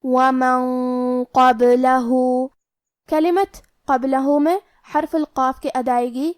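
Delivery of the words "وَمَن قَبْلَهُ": slow chanted وَمَن with the n hidden in a long, heavy nasal hum before قَبْلَهُ; tajweed recitation of وَمَن قَبْلَهُ, drawn-out heavy hum into the ق; heavy held ghunnah. Shown as a demonstration. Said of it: "ہمیں یہ غنہ کو بھی بھاری آواز میں ادا کرنا".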